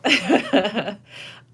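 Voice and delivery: a loud delighted laugh. Real, not acted. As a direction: burst of loud high laughter. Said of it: "I don't know".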